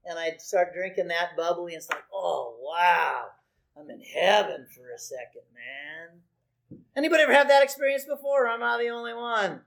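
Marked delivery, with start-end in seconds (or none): 2.1-6.2 blissful relief. Retold or imitated.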